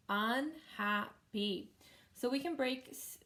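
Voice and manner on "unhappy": "unhappy" slowly broken into un-hap-py; syllable by syllable. Shown as a demonstration. Teacher showing how to read in chunks, "unhappy" split syllable by syllable. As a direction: say "unhappy" in three separate chunks. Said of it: "words into syllables".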